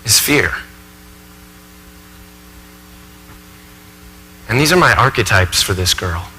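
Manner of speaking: subdued stage monologue, weighed down by unease; troubled professor confessing. Acted. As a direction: recount a frightening memory haltingly and softly. Professor haltingly recalls frightening encounter.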